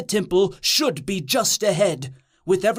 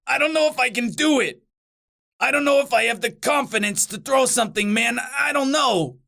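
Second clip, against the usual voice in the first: fast, fretful protest; anxious demon buddy backing out; self-doubting demon; 3 semitones higher than usual. Acted. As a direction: rush it out, fretting about your own ability. Plays Bothersnatch panicking about throwing.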